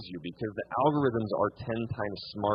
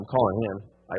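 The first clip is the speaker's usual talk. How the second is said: ordinary talk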